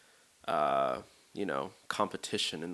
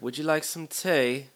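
imitating a British friend, higher pitched; bad British accent; 6 semitones higher, 4 dB louder than usual. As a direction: offer it politely in a fake British accent. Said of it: "sorry for this horrible accent".

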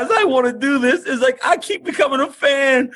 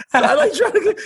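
real laugh, higher; giggling through the words; 8 semitones higher than usual. Real, not acted. Laughing at own fandom.

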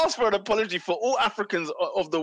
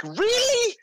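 incredulous. Real, not acted. Incredulous reaction to Terry abroad.

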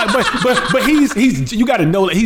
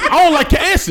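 high-pitched mock tough talk; joking intimidation; 13 semitones higher than usual. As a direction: play the bully, only in jest. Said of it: "Lex, Lex was joking".

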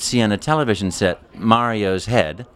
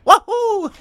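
very high, louder aged Mario whoop; older Mario woohoo; 5 dB louder than usual. Shown as a demonstration. Mario's cheer imagined twenty years on. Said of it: "sound a little bit more like this".

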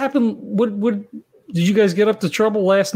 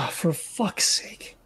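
sighing whisper under breath; exasperated whisper; 9 dB quieter, much slower than usual. Acted. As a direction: breathe it out, fed up. Exasperated sigh.

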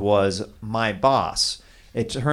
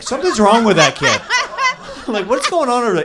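alarmed; 9 semitones higher, 4 dB louder, faster than usual. Retold or imitated. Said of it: "you're like".

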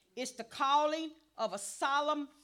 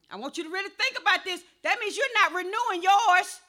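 loud, insistent pulpit exhortation; full-force preaching; 3 semitones higher, 8 dB louder than usual. Real, not acted. Preacher scolding people too busy for church, forceful preaching. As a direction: admonish the congregation with punched key words.

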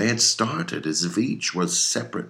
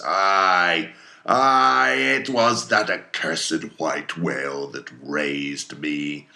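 drawn-out anguished wail on the ayes; loud sobbing shout; 4 dB louder, much slower than usual. Acted. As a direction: cry out, then ease into slow bitterness. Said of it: "he shouted with a terrific loud animal sob".

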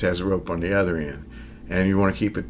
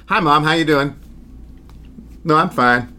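Southern twang chatting with his mother; Southern accent; 6 semitones higher than usual. Retold or imitated. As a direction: slip back into his Southern accent for mom. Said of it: "She had heard me talk as a Southerner because when I talked to my mom on the phone".

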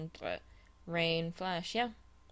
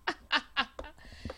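laughing; 9 semitones higher than usual. Real, not acted. Co-host laughing along.

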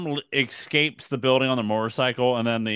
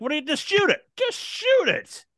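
very high, squeaky exasperated plea; exasperated mock yell. Host yells at film's hero, high-pitched exasperated plea. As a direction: plead in falsetto, stretching the last command. Acting exasperated viewer yelling at film.

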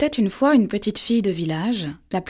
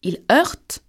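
usual speaking voice

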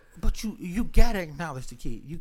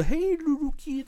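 high, put-on talking-to-children voice; mock kiddie talk; 11 semitones higher, 3 dB quieter than usual. Shown as a demonstration. Man mocking how adults talk to kids, high put-on voice. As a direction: imitate a grown-up cooing at a child. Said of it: "I don't do the".

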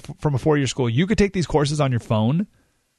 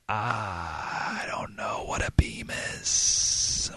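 helpless whisper; 6 semitones lower, 9 dB quieter, much slower than usual. Retold or imitated. Retold clueless reply to lumber guy.

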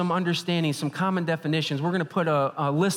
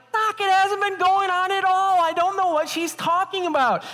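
very high, louder squealed protest; exaggerated defensive outburst, pitched way up; frantic mock denial; 5 dB louder than usual. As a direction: deny everything in hammed-up panic. Retold frantic denial to doctor.

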